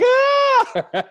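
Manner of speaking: loud, very high scream; piercingly high mock banshee shriek; imitated banshee scream. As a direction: scream like a human whistle.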